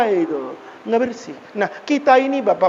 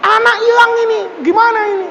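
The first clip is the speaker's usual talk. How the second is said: agitated outcry, pitched way up; panicked; 11 semitones higher, faster than usual. Acted. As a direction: wail it like a frantic parent. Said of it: "kan panik".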